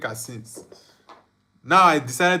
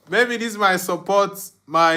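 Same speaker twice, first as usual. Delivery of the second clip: usual speaking voice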